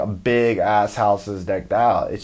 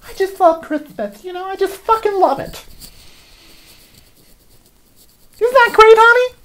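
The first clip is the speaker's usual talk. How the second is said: much higher, sing-song doting wife; gushing housewife; 4 dB louder than usual. Acted. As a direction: coo over Christmas like a giddy suburban mom. Comic wife Peggy voiced.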